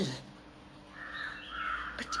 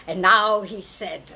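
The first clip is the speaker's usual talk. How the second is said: ordinary delivery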